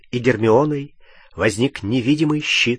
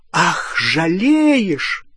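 high, long-drawn incredulous jeer; scornful mockery; 6 semitones higher, much slower than usual. Hermione throwing Ron's apology back, shrill scorn. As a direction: stretch the word up in scorn. Гермиона язвит над извинением Рона.

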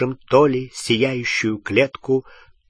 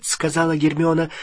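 ordinary delivery

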